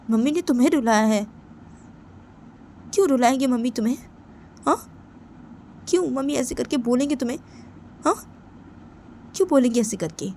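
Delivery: loud, high indignant demands; angry shouting on a friend's behalf; outraged questioning. Acted. Outraged at mother upsetting friend.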